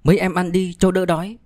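high, lightly voiced woman; woman's voice. A narrator's reading. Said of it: "Khách cất giọng nữ". (giọng nữ cao, mời các em ăn)

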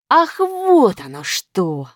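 drawn-out, mildly indignant exclamation; stretched-out, cross realization; angry. A narrator's reading. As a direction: react slowly, with dawning storybook outrage. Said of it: "рассердился Плюс".

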